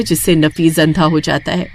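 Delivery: usual speaking voice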